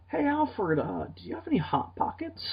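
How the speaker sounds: a whiny snack-craving Batman, lightly voiced; Jim Gaffigan Batman. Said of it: "Jim Gaffigan to play Batman".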